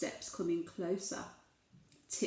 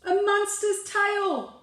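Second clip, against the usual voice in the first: high, louder, slow shocked reveal; mock-startled reveal; 10 semitones higher, 5 dB louder, slower than usual. A narrator's reading. Reading to a child, a slow high mock-scared reveal. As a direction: land the scare slowly.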